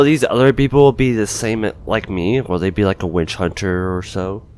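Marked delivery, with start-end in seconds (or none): none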